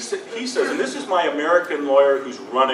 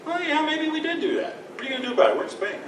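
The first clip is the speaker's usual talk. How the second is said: blithe admission; faster than usual. Retold or imitated. Spanish company shrugging off a breach claim, mock-nonchalant. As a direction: brush it off in a light, raised voice. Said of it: "They're just like".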